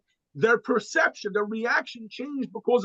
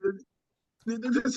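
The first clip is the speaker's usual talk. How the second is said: ordinary delivery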